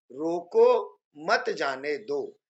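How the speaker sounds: रोको drawn out, pause, then a slow मत जाने दो; pause after रोको. Shown as a demonstration. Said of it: "रोको के बाद में हम रुक रहे हैं". (रोको के बाद विराम, धीरे-धीरे)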